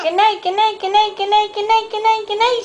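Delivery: high, singsong string of goodnights; chorus of animals. Acted. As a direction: chirp it over and over, very high. Many animal voices answer her.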